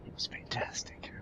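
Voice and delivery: whisper. Real, not acted. Aside whispered to co-host.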